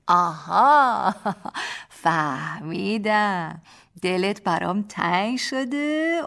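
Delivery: put-on slow, drawn-out baby talk; babyish tone. Husband teasing his crying wife in babyish tone. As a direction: tease her like a small child would. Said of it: "با همان لحن بچگانه".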